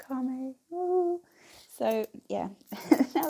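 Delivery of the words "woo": high, loud "woo"; playful whoop. Real, not acted. Vlogger showing off her nice earrings, playful whoop. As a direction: let out a quick excited whoop.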